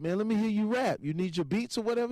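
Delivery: pitched well up, pressing the newcomer; eager challenge. Retold or imitated. Retold challenge to the new rapper.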